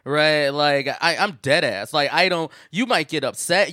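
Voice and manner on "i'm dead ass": normal talking voice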